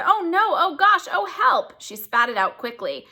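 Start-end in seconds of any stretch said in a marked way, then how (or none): 0.0-1.7 high, loud, drawn-out cries of disgust; disgusted panic. Acted. Sophie reels from the snozcumber taste, high panicky cries. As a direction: plead for help after a bad taste.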